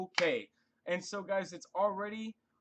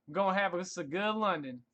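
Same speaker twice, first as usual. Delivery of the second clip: country accent; 3 semitones higher, 3 dB louder than usual. Shown as a demonstration. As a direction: say it like a good ol' Southern country boy. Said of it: "the country accent on you".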